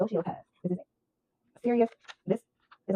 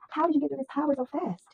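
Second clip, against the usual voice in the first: higher, slow mock-amazed voice; mocking the callers; 7 semitones higher than usual. Reader mimics people calling the viewer, mock amazed and slow. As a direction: ham up their fake wonder. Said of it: "call you and ask you".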